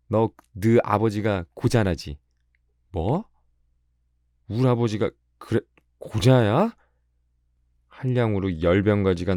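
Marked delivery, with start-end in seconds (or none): 0.1-2.2 slow, higher-pitched needling; acted teasing girl; mocking taunt. 2.9-6.7 high, incredulous comeback, rising sharply; acted affronted boy, pitch shooting up; indignant retort.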